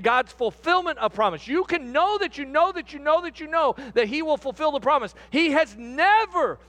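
fervent pulpit delivery; emphatic preaching. Real, not acted. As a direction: build to a high, ringing declaration. Preaches at rising force.

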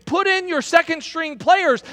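high, loud call across a football field; retold yell across the field. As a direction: yell it to the other sideline like a rival coach. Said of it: "was yelling across the field saying".

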